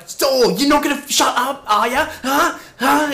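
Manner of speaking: high, loud confrontational imitation; in-your-face aggression. Stranger squaring up to the sales rep, retold aggressive challenge. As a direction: push each question at him, pitch way up. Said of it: "right up in my face".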